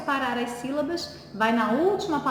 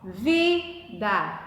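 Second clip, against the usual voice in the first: split into syllables; 3 semitones lower than usual. Shown as a demonstration. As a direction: separate "vida" into two syllables, lifting the stressed "vi". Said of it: "vejo a sílaba tônica". ("vida" separado em sílabas: vi-da, com "vi" tônico)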